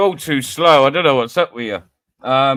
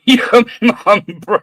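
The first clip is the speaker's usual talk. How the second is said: laughing disbelief; 3 semitones higher than usual. Real, not acted. Reading a mum joke, laughing.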